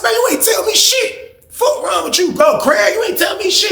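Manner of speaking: squealed, very high mock protest; panicked denial. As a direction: act out a desperate denial, voice way up. Acted denial to Craig.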